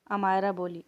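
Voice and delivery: ordinary delivery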